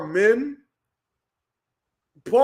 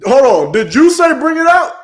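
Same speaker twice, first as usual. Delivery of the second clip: loud, startled disbelief; incredulous; 2 semitones higher, 9 dB louder than usual. Real, not acted. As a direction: cut in loudly, pitch rising on the question. Startled reaction to words in clip.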